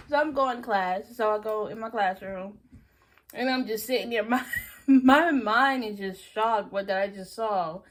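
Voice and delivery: ordinary delivery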